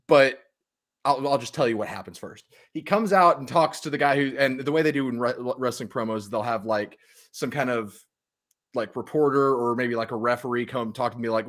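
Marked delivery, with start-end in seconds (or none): none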